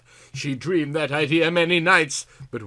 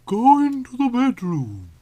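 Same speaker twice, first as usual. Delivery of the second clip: pressing, much higher command; urging; 10 semitones higher, 4 dB louder than usual. Acted. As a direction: push her toward the door. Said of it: "she urged Callie".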